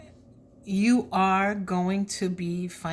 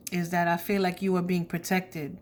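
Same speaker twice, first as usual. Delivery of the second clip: usual speaking voice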